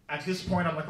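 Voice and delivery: ordinary talk, nothing marked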